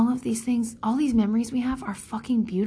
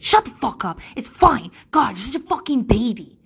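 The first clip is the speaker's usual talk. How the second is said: harsh, loud mock scolding of a crying child; imitated angry mother, louder; mom yelling; 3 semitones higher, 5 dB louder than usual. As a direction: snap at the kid like her mother did. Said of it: "she would be like".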